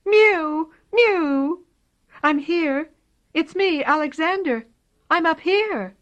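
high, loud kitten cries; lost kitten shouting up from a tree; kitten calling. A narrator's reading.

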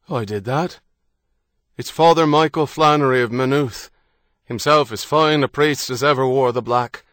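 loud, hearty Irish man calling out; a confident Irishman hailing strangers; bold Irish traveller. Acted. Harrody answers loudly.

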